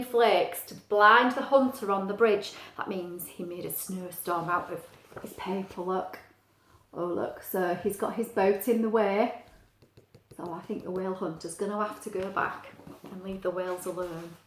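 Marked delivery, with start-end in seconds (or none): none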